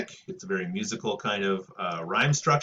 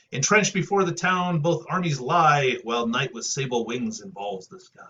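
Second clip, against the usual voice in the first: measured verse reading, opening louder; poem recitation; 5 semitones higher, 4 dB louder than usual. Acted. Teacher reads Virgil battle couplet aloud, declaimed. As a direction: read it as grand epic verse.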